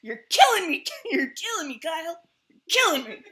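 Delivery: loud, high laughing "Damn it!"; playfully furious at Kyle; mock frustration.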